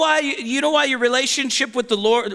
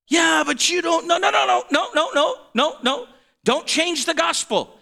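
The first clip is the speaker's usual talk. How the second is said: rising protest; 5 semitones higher than usual. Acted. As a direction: wave off a wrong idea with a run of quick higher noes. Preacher rebukes imagined objection.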